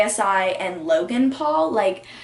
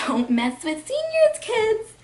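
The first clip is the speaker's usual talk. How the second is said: high, squeaky mock warning; mock teacher warning; 13 semitones higher than usual. Teacher warning freshmen about seniors, exaggerated high voice. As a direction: shoot way up in pitch on the warning. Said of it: "teachers being like".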